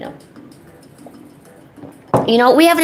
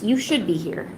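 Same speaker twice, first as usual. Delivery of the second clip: ordinary talk, nothing marked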